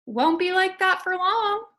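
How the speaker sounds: lightly voiced cheerful janitor. A narrator's reading. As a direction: speak up as the friendly janitor. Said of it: "said janitor".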